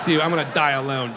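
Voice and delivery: ordinary talk, nothing marked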